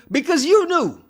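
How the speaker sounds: high, punched accusation; accusing. Acted. Host calls out the partner who knew, accusing. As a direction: call her out, pitch jumping up.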